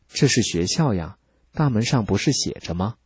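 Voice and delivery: usual speaking voice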